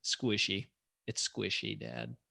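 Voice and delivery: hushed teasing daughter voice; deadpan quiet verdict. Retold or imitated. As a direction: poke fun quietly like a little girl. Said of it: "she just says".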